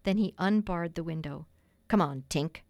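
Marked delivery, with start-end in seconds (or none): 1.9-2.6 low-pitched, lightly scornful call; understated sneering cry; mild sneer. A narrator's reading. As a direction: call it with a touch of contempt. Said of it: "cried, with a frightful sneer".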